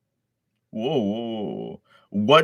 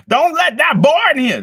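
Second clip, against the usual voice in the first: much higher, louder falsetto crowd voice; angry crowd; 11 dB louder than usual. Acted. Crowd shouting at Deku to stay out, shrill shout. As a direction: shriek it as a panicked townsperson.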